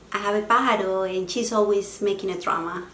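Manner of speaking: ordinary talk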